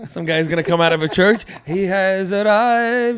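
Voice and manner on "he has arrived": loud, high, drawn-out mock announcement; grand proclamation. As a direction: proclaim it slowly, hammed up. Mock arrival announced from a church.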